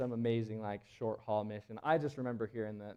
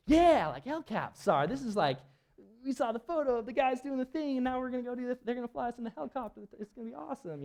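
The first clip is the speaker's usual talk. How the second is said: eager; 12 semitones higher, 6 dB louder than usual. Retold or imitated. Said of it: "you're kinda like".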